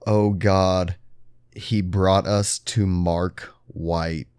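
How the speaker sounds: low, slow and soft, with long pauses; hushed dread. Retold or imitated. Retelling the soldier's last words over the phone, hushed dread. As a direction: let the awful realization land slowly, quietly. Acts soldier's horrified final line.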